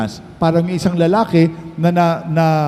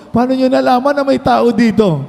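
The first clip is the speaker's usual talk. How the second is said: high-pitched acted astonishment; amazed relief; 7 semitones higher than usual. Castaway asking how rescuers found him, acted amazement. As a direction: ask it high, in amazed gratitude.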